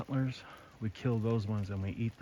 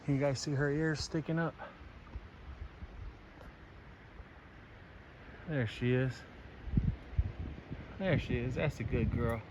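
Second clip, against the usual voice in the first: soft and tender; 4 semitones higher, 8 dB quieter than usual. Real, not acted. Spotting the doe, soft voice.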